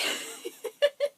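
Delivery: high, breathless burst of laughter; giddy laugh. Real, not acted. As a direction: laugh helplessly after a near miss. Laughs after near spill into tea.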